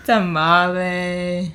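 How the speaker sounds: TAMALE very slow and drawn out, Spanish-style; playful Spanish pronunciation. Pretend. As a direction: stretch "Tamale" playfully, savouring each Spanish syllable. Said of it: "Just do the tostada over and over again".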